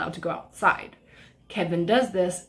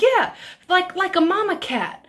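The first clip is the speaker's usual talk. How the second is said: high-pitched mimicry; mock neighbor's answer; 10 semitones higher, 4 dB louder than usual. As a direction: imitate a neighbor conceding in a high voice.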